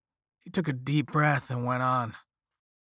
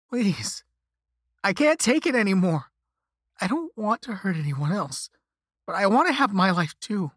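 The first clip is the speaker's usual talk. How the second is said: higher, tearful begging; crying; 7 semitones higher, 5 dB louder than usual. A narrator's reading. Cursed victim begs caller to take it back, crying. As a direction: plead through tears, voice pitched up. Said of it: "I started crying then".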